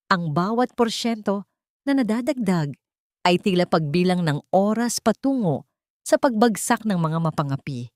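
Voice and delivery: ordinary delivery, nothing marked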